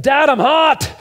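put-on high, loud little boy complaining; high loud whine of a small boy; whiny kid voice. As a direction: play a five-year-old waking his dad. Son voiced waking dad.

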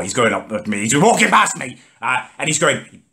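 usual speaking voice

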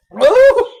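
high loud pained cries; mouth burning. Real, not acted. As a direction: cry out from the chili heat. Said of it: "Yandım". (ağzı yanarak haykırma)

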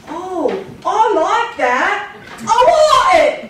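high, slow demanding British mom. Retold or imitated. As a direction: play the Queen wanting the bracelet. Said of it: "my mom is British".

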